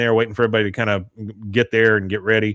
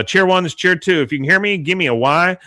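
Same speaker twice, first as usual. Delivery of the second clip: Peggy commanding the squad over a headset, higher; headset announcer; 7 semitones higher than usual. Retold or imitated. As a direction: bark it into the headset mic.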